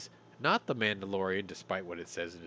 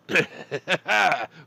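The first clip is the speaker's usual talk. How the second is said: real laugh breaking the bit; loud burst of laughter; 8 semitones higher, 11 dB louder than usual. Real, not acted. Host laughs out loud mid-skit. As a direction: crack up loudly.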